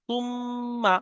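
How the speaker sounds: সুম্মা with the doubled ম humming in the nose; nasal ghunna. Shown as a demonstration. Teacher demonstrating ghunna on মিম for students. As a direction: hold the ম of সুম্মা as a nasal hum before the final মা. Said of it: "নুন এবং মিম এর উপরে তাসদীদ আসলে".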